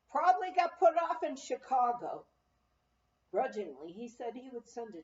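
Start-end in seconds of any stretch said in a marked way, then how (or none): 0.1-2.2 loud, careless shrug of a reply; grudging. A narrator's reading. Clerk answers grudgingly.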